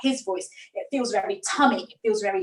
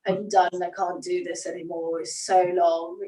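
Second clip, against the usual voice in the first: weary, lower groan from the throat; tired back-of-throat voice; 6 semitones lower than usual. Shown as a demonstration. Teacher shows how tired talk sounds, tired throat voice. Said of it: "that's basically what that sounds like".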